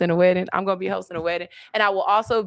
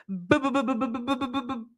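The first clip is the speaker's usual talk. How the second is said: mock stammer; 5 semitones higher, 5 dB quieter, faster than usual. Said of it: "He said".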